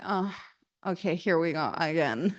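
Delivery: low, soft, resigned sigh of words; weary resignation. Retold or imitated. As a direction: drag yourself into another day. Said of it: "most people wake up".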